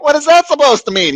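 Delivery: high, loud, fast retort; outraged disbelief. Retold or imitated. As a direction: fire it back, hammed-up and incredulous. Repeats his outraged question to priest.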